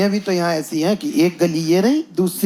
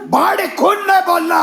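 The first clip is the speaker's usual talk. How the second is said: much higher shouted broken Hindi; mock driver shout; 9 semitones higher than usual. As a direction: yell it like the angry driver. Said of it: "वो भी चिल्ला रहा है".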